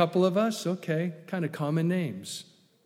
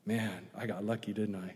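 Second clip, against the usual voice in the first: soft murmured aside; quiet aside; 9 semitones lower, 12 dB quieter than usual. Real, not acted. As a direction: drop to a low murmur. Aside about his wife.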